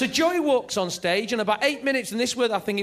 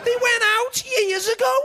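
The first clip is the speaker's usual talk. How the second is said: bratty Scouse bride; 5 dB louder than usual. Retold or imitated. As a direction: sneer it down at him from way up high. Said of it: "her key opening gambit".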